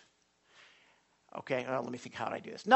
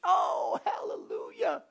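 joyful hallelujah; 12 semitones higher, 3 dB quieter, slower than usual. Real, not acted. Praise after reading scripture.

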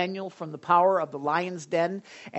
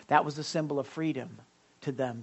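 ordinary talk

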